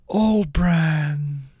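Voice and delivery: ordinary delivery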